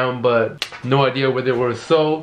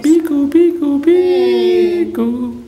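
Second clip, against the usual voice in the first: very high, loud cartoon squeak; squeaky silly voice; 6 dB louder, much slower than usual. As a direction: chirp it like a tiny creature. Silly high noise call.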